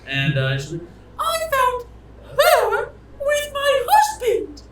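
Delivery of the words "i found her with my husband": very high, drawn-out falsetto accusation; outraged wife. Acted. Wife publicly accuses her rival.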